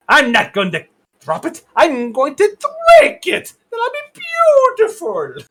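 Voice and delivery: hammed-up cartoon villainess; Yzma. Quoting the film villain, put-on scheming voice. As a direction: lightly play a scheming villainess. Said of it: "like Yzma from".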